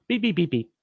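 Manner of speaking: high beeping imitation; high mock electronic beeps; camera beeps.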